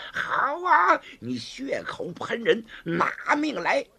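high cry then hissed, slow threat; murderous threat. Acted. Gao Feng turning on the woman before attacking, seething. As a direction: spit the words before striking.

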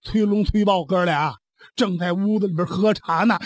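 ordinary delivery

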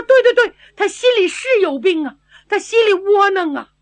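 loud, high, eager "对，对，对"; eager agreement. Acted. Old consort eagerly agreeing with the young doctor, loud and excited. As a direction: burst out agreeing at once. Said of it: "老驸马说".

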